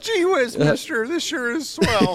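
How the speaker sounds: put-on high, slow gee-whiz kid voice; wholesome 1950s kid. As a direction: play a wide-eyed innocent boy. Mocking naive drinker.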